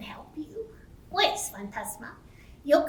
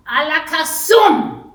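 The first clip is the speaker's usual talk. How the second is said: shouted magic word; loud magic shout; 4 semitones higher, 13 dB louder, slower than usual. Acted. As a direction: belt out the spell. Witch casts incantation.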